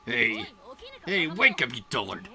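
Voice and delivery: loud, scolding wake-up yell; angry shout. Acted. Yelling at a sleeping comrade to wake up, loudly. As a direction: shout to shake him awake.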